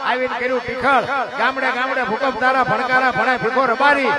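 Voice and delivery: chanted verse building from steady to loud and high; folk poem recitation. Acted. Storyteller reciting the quake poem to the dayro crowd, rising chant.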